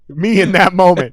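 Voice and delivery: normal talking voice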